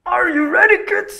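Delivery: very high, loud cartoon pirate call; hammed-up high cartoon captain shout; SpongeBob intro captain. Shown as a demonstration. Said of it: "Tiruin suara intro SpongeBob".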